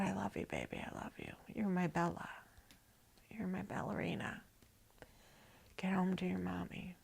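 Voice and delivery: soft loving whisper; tender whisper. Retold or imitated. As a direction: whisper it like a goodbye. Tearful father's whispered goodbye.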